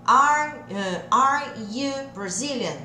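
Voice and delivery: slow, each word set apart; separado, devagarzinho. Shown as a demonstration. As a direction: spell it out word by word. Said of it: "Eu quero falar assim". (devagar, tudo separadinho)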